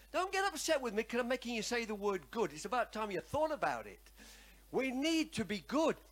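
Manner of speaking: normal talking voice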